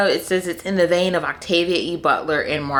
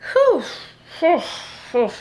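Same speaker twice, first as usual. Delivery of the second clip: whew; 11 semitones higher than usual. Real, not acted. Bracing for a sad book.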